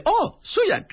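high-pitched "oh" of understanding; delighted recognition. Retold or imitated. Japanese woman grasping his introduction, surprised recognition. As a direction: act out someone suddenly getting it.

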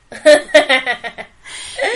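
loud, rising burst of laughter. Real, not acted. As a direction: crack up at the memory. Laughing at her own story.

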